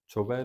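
ਚੁਭੈ with a pure oral final vowel; no nasal ending. Shown as a demonstration. Teacher showing students the reading, ਚੁਭੈ without nasalisation. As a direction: end ਚੁਭੈ without any nasal sound. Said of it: "ਤੇ ਬਿੰਦੀ ਨਹੀਂ ਲਾਉਣੀ".

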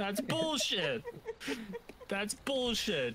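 high, slow drawn-out protest; mock outrage. Real, not acted. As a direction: stretch out the disbelief.

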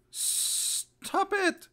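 very high, strained mock command; exaggerated squeal. Shown as a demonstration. Mocking the advice against yawning, exaggerated high squeal.

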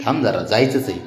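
ordinary talk

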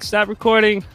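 ordinary delivery

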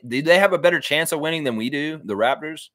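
normal talking voice

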